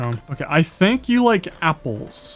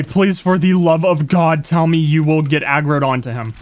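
ordinary delivery

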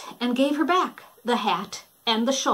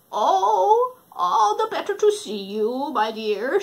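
high sly fake granny; lightly voiced false grandma; wolf faking Granny; 6 semitones higher, 4 dB louder than usual. Wolf answering Red, high sly granny.

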